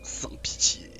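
sans pitié soufflé tout bas; murmure-le comme une menace; chuchoté. Pretend. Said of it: "Regarde, moi, je tue". (chuchotement menaçant)

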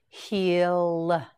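Hectic stretched slowly, each sound held; slow drawn-out word. Shown as a demonstration. Teacher modelling Hectic for learners, slowly stretched. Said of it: "teacher models new word".

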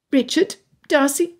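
high-pitched, formal announcement; defiant daughter. A narrator's reading. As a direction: address your allies in a high, pointed voice. Daughter formally addresses her co-conspirators.

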